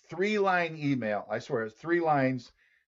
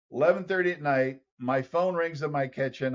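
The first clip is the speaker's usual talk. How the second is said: ordinary talk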